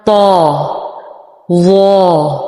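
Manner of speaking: طا held long, full and heavy; heavy طا drawn out. Shown as a demonstration. Teacher modelling the correct heavy طا. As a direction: stretch طا heavy. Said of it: "طوا نہیں بلکہ".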